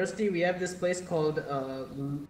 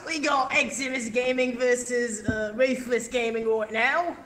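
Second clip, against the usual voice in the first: higher, slow mock British commentary; British caster accent; 6 semitones higher, slower than usual. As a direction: announce the match like a posh British caster. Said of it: "then I'm doing a British accent".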